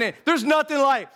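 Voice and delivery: normal talking voice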